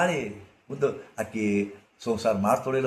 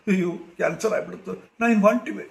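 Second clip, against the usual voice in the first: mock panic; 8 semitones higher than usual. Mocking a panicked cancer worrier.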